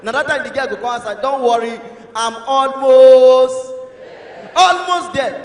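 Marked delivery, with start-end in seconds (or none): 1.2-5.4 hugely drawn-out, loud 'almost'; stalling girlfriend. Acted. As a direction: stretch the excuse out and out. Girl stalling on the phone.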